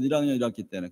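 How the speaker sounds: 일 학년 일 학기 때는 dropped low and soft. Shown as a demonstration. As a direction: trail off 일 학년 일 학기 때는 as a soft aside. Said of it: "배우잖아요, 여러분".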